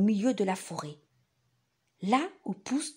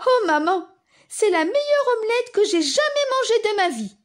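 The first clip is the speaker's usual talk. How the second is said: excited; 11 semitones higher, 6 dB louder than usual. Acted. Paul delighted.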